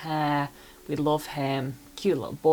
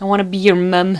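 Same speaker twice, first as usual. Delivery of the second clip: louder burst of adoring affection; gushing exclamation; 3 semitones higher, 9 dB louder than usual. Real, not acted. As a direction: blurt it out, overcome with fondness. Gushing over cute character.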